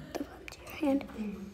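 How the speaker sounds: whispered instruction. Real, not acted. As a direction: breathe it under your breath. Whispered aside.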